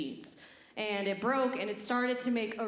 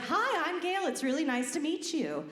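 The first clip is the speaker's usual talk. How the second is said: higher, perky customer-service greeting; 6 semitones higher than usual. Retold or imitated. New line cook meeting a colleague, customer service voice. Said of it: "the customer service voice".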